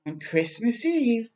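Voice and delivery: ordinary delivery, nothing marked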